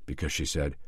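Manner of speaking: usual speaking voice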